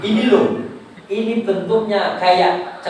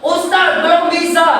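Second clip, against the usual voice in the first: high-pitched mock objection; voiced plea to the teacher, pitched high; congregant's protest; 10 semitones higher, 5 dB louder than usual.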